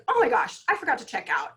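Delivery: oh no, I just walked out without paying; panicked realization. Retold or imitated. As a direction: blurt it out in sudden horror.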